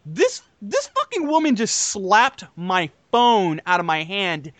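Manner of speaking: ordinary delivery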